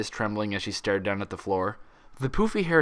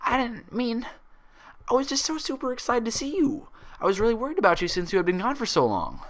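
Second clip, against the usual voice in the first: very high, trembling explanation; quivering voice; 14 semitones higher than usual. A narrator's reading. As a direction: tremble through the excuse. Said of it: "her voice quivering".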